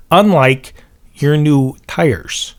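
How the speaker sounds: put-on a tire-shop guy with a Chicago accent makes a pitch; mock Chicago mechanic. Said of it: "the mechanic has ... a Chicago accent".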